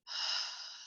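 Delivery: long audible exhale through the mouth; soft releasing whoosh of breath; open-mouth sigh. Shown as a demonstration.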